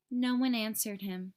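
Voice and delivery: ordinary delivery, nothing marked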